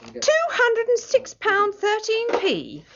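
slow, high-pitched outrage; incredulous, pitched well up and drawn out; indignant disbelief. Acted. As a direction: drag the paltry sum out in protest. Typist protests at measly debt.

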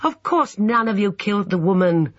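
high, upset protest; anxious high-voiced insistence; distressed cry. A narrator's reading. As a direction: protest with the pitch lifted. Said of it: "cried Emma".

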